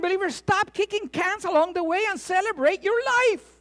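high, loud, ringing exhortation; full-force preaching. Acted. Preacher urging his congregation to celebrate life, full force. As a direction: urge them on like a revival preacher.

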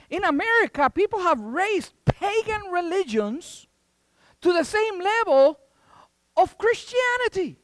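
high-pitched, emphatic sermon delivery; impassioned preaching. Real, not acted. Preacher rebuking a nation turned from God, impassioned. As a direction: preach it with rising indignation.

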